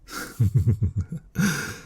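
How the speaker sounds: chuckle, pleased with yourself; teasing chuckle. Acted. Teasing incubus laughs at listener.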